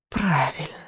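soft witch's hiss. A narrator's reading. Witch grudgingly confirms the boy's guess, hissing. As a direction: let it out as a soft, dying hiss. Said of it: "прошипела ведьма".

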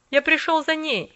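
loud, firm insistence; boy standing his ground. A narrator's reading.

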